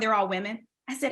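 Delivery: ordinary talk, nothing marked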